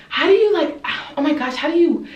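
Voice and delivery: high, mock-amazed questioning. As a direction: gush the question high, stumbling over it. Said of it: "followed up by all these".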